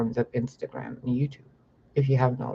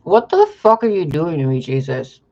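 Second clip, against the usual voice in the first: angry outburst; 2 semitones higher, 7 dB louder than usual. Real, not acted. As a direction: cry it out at Jesus in frustration.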